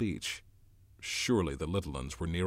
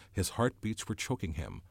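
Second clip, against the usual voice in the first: usual speaking voice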